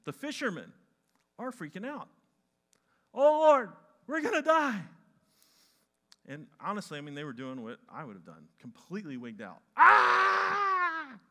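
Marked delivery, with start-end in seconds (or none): 3.1-4.9 high, loud terrified wail; panicked cry. Acted. Disciples panic in storm. 9.8-11.2 high, loud panicked scream of drowning men; terrified scream. Acted. Disciples panic in storm.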